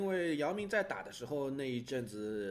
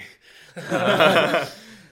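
loud laugh; 5 semitones higher, 21 dB louder than usual. Real, not acted. Laughing at the point just made.

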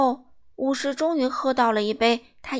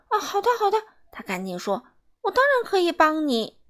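much higher hurried yes; hasty agreement; 7 semitones higher than usual. Acted. Miser hastily agreeing to help the boy, high hurried. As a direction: rush the words out nervously.

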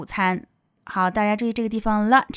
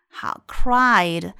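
ordinary delivery

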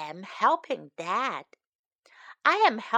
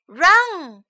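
loud urgent yell of run; dad yelling a warning at the child; 6 semitones higher, 10 dB louder than usual. Acted. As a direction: shout a warning. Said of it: "Dad yells".

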